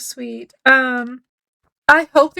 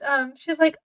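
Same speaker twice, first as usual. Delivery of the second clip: usual speaking voice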